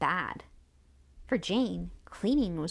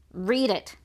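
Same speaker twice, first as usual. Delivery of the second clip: louder, impatient order; urgent raised-voice command; shouted command; 2 semitones higher, 6 dB louder than usual. A narrator's reading. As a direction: call out a demand to the reader. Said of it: "shouted Skye".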